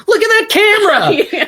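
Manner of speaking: high-pitched excited cry; imitated exclamation. Co-host quoting Lauren's reaction to the camera, high excited cry. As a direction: blurt it out high, mimicking amazed delight. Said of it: "remember Lauren saying".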